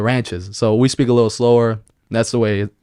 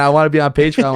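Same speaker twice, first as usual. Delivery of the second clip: ordinary delivery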